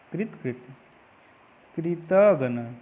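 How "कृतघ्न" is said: drawn-out demo. Shown as a demonstration. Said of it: "ये सही होता है कृतघ्न".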